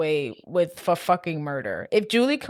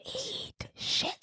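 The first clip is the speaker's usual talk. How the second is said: slow, soft, breathy whispered insult; sultry whisper; 13 dB quieter than usual. Retold or imitated. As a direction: purr it out like a sweet kiss-off. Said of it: "she says it's so cute and slow and sultry".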